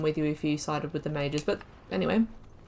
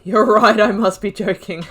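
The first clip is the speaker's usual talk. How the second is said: loud reading; 2 semitones higher, 10 dB louder than usual. Real, not acted. Reads dialogue choice aloud.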